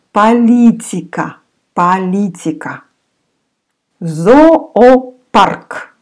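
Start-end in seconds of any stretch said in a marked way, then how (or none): 4.0-5.9 Зоопарк said high with both о as "a", "zapark"; teacher's emphatic Зоопарк; higher model word. Shown as a demonstration. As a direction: call out Зоопарк with а vowels. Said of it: "We say again with а".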